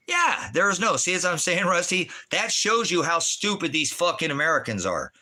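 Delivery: high-pitched, heated rant; exasperated outburst. Real, not acted.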